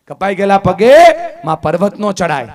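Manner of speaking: loud proclamation. Acted. Speaker hammering home the daughter's despair, loud. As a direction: throw કપાઈ ગયેલા પગે out to the hall, then land નો hard.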